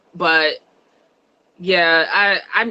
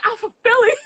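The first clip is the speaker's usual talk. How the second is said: mock Kelly; 13 semitones higher than usual. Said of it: "She had that whole English accent".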